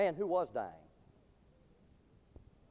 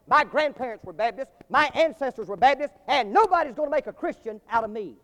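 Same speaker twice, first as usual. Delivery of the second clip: animated sermon storytelling; 4 semitones higher, 6 dB louder than usual. Acted. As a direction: preach the anecdote, higher and emphatic.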